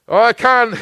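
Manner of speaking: loud, high, fervent sermon delivery; pulpit-pounding preaching voice; full-force preaching. Acted. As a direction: preach it out big to the congregation.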